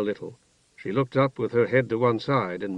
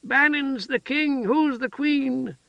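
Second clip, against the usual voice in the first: very high, slow excited voice of a simple woman; eager childlike cry; 14 semitones higher, slower than usual. A narrator's reading. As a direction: call out like a delighted child. Heather keeps pestering.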